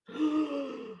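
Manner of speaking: sharp gasp of outrage; shocked gasp. Real, not acted. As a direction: gasp in disbelief. Outraged by bonus ad.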